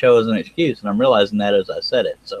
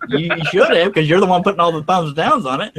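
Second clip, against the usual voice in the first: higher, laughing mock accusation; teasing accusation; 8 semitones higher than usual. Real, not acted. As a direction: accuse a friend playfully, pitched up.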